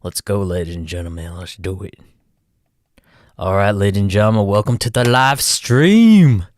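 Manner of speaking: usual speaking voice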